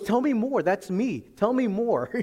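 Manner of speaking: high, loud, excited pleading; eager imitation. Acting the man's eager response.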